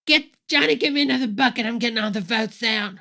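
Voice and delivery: loud, high, eager thief shouting; excited vote thief. Pretend. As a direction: call out to a helper mid-heist. Acting a vote thief siphoning.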